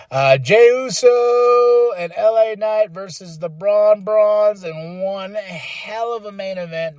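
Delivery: ordinary delivery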